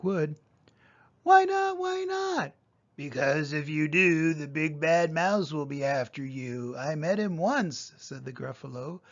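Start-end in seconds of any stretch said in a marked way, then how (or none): 1.2-2.5 put-on squeaky little Gruffalo child, much higher. Child begs her dad in a much higher voice. 3.0-7.9 a hint of a big monster father; lightly voiced Gruffalo dad. Dad warns his child, lightly voiced monster.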